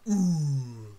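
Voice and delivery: low drawn-out rapper ad-lib; E forty. Retold or imitated. Imitating a rapper for a friend, low drawl. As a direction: let it sink into a long, low ooh. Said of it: "I actually imitated E forty better".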